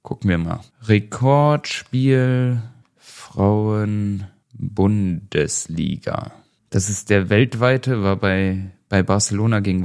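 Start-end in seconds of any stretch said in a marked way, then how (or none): 0.8-6.3 very slow, syllables stretched out; absent-minded, dragged-out words while searching; drawn-out search muttering. Real, not acted. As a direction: say it slowly as you type it. Typing a search aloud while googling.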